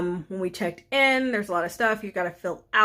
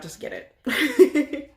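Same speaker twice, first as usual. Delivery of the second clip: playful urging; 7 semitones higher, 3 dB louder, much slower than usual. Real, not acted. Urging viewers to get vaccinated.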